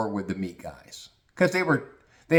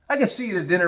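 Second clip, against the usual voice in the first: usual speaking voice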